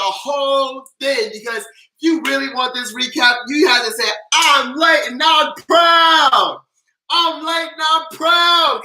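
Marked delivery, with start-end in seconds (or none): 4.3-8.8 high, loud and slow, stretched out; proud all caps shout. Shown as a demonstration. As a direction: belt it like a proud chant. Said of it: "all caps".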